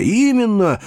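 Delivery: keen, high-pitched assent; eager agreement. A narrator's reading. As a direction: jump in eagerly. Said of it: "подхватил дядя".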